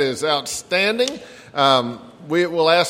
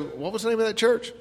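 high, puzzled, trailing off; trying to remember, forgetful; puzzled question; 8 semitones higher, 5 dB quieter than usual. Retold or imitated. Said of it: "they go".

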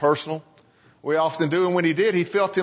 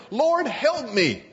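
pleading; 8 semitones higher, slower than usual. Acted. A kneeling mother begging the Lord for help, pleading. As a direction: beg for help, broken.

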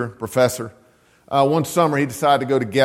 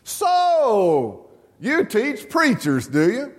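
higher, lightly voiced old man; old man's amused question; 8 semitones higher, much slower than usual. Old man to the homiletics professor, higher amused voice. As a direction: tease the preacher-teacher gently.

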